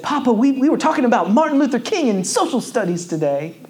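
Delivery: put-on high, excited little boy voice; young son telling papa about school, high and eager; child's voice. Preacher voicing young son's words.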